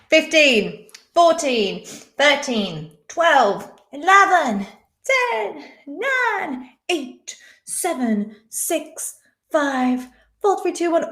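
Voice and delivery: slow dramatic countdown. Real, not acted. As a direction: count down the seconds. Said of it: "counting down quiz timer".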